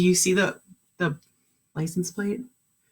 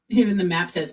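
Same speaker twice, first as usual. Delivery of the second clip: plain reading voice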